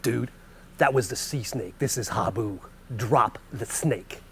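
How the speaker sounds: low urgent hush, slowing on "Drop the snake"; tense low warning. Retold or imitated. Narrator quietly urges his friend to drop the viper, low serious hush.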